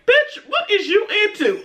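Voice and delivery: high-pitched, incredulous mock outburst; high retold disbelief with a laugh behind it; incredulous friend. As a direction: act out a baffled friend calling you out. Friend's shocked reaction, retold.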